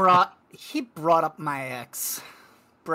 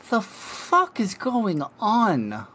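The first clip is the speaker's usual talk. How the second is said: slow, baffled high grumble; 7 semitones higher, slower than usual. Acted. Maru eyeing the motorcycle in slow disbelief. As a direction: drawl it out in disbelief. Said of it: "just give a".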